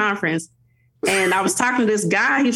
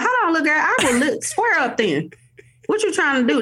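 put-on higher, sassy mom warning her toddler; mock stern mom; 8 semitones higher than usual. Mom to toddler who snatched her arm, mock stern scolding. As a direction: put a little girl in her place, half joking.

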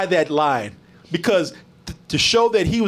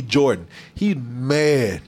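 usual speaking voice

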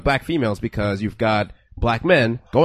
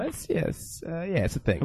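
whispered, much softer admission; sheepish whisper; 4 semitones higher, 12 dB quieter than usual. Real, not acted. Admitting to cohosts prom mattered, sheepish whisper. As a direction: murmur it like a guilty confession.

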